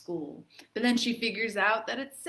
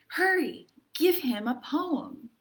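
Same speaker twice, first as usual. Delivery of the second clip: higher quick urgent mom; 6 semitones higher, 3 dB louder than usual. Acted. Arthur's mom telling him to hurry, urgent.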